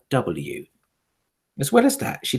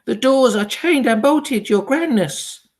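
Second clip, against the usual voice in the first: much higher, fawning answer; servile witch reply; 11 semitones higher, 4 dB louder, slower than usual. A narrator's reading. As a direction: answer as a cowed underling. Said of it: "answered a voice in the audience".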